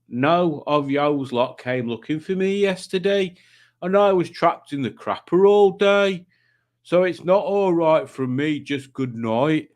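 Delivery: put-on slow, sulky comic regional accent; hammed-up slow accent, complaining; Tommy's put-on accent. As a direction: read it in a drawn-out imitation accent. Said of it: "reading things in Tommy's accent".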